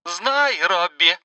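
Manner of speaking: put-on high, menacing old woman's voice; threatening mother. A narrator's reading. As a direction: taunt like a gloating mother. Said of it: "угрожающе заявила она".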